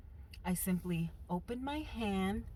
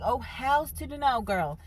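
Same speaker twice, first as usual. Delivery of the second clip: sassy; 5 semitones higher, 4 dB louder than usual. Retold or imitated. Refusing the fight.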